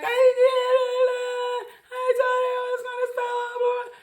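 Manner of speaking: very high crying wail, mock sobbing; hammed-up sob of a caught wife; wailing plea. Wife pleading, retold wailing.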